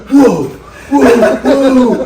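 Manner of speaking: high, loud laughing whoa. Retold or imitated. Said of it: "And he's like".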